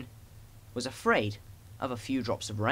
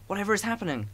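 startled trucks; 7 semitones higher, faster than usual. A narrator's reading. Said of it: "screamed the trucks".

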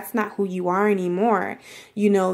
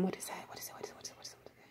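whispered to herself; 25 dB quieter, much faster than usual. Real, not acted. Searching memory for a word.

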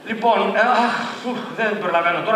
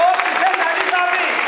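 emphatic call; 9 semitones higher than usual. Shown as a demonstration. Επανάληψη με ένταση.

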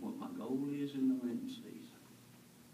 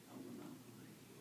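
breathy whisper of doubt; 15 dB quieter, faster than usual. Acted. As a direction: whisper Thomas's refusal.

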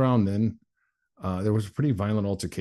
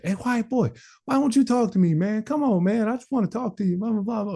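very high imitation of nonstop calling; pestering caller; 13 semitones higher, 3 dB louder than usual. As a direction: pester him across the hall, high-pitched.

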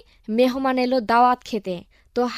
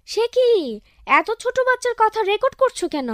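surprised friend; 8 semitones higher than usual. Acted. Friend surprised at recording baby.

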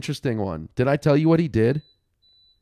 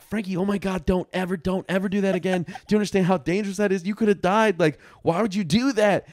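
imitating a worried mom, higher pitched; rushed mock scolding, pitched up; lightly acted mother freaking out at her kid; retold freaking-out mom; 6 semitones higher, faster than usual. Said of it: "she starts freaking out".

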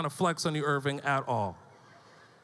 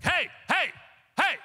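put-on sudden high-pitched "hey"s at a kid; abrupt attention-grabbing dad yelps; sharp parental call-out; 11 semitones higher than usual. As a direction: cut off a tantrum with sharp, high calls. Father interrupting his son's tantrum.

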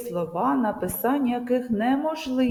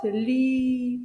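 «лі» drawn out on a high, held note; stressed syllable «лі»; 3 semitones higher than usual. Shown as a demonstration. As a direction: stretch the vowel of «лі» to show the stress. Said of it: "вимовляємо з більшою силою голосу".

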